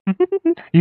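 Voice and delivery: a quick teasing laugh, pitched high; short high laugh. Acted. Teasing the nervous friend.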